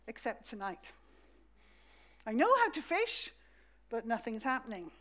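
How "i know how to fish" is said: higher and louder, insisting he knows; a bit indignant about his own skill; a hint of a stubborn Peter. Peter voiced, insisting he can.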